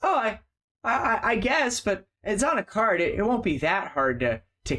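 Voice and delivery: hesitant, trying to brush them off; nervous traveler. Acted. GM voicing the traveler caught in the alley, nervous and stammering.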